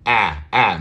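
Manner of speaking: "Ah" as front A in cat; repeated isolated "Ah", cat vowel; short A sound. Shown as a demonstration.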